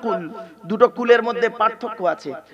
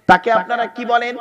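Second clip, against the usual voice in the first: ordinary talk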